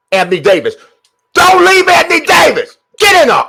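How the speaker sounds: high, loud yelling for the shot; will the ball in, loudly; pleading yell. Real, not acted. Streamer yelling at Anthony Davis to score.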